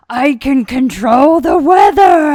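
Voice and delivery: slow, loud, booming god voice; mock almighty. Jokingly imagining Ouija power, booming godlike shout. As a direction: proclaim like a deity. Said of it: "I would have the mindset".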